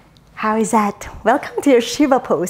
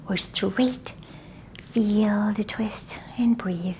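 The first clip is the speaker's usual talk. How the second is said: soft unvoiced relaxation whisper; whispered cool-down; 5 semitones lower, 11 dB quieter than usual.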